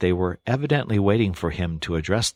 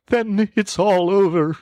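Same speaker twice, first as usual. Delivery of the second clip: much higher, louder wailing cry; sobbing; 13 semitones higher, 6 dB louder than usual. A narrator's reading. Toad wailing into the sofa cushions, much higher and louder. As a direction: wail it out in despair. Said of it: "sobbed the Toad".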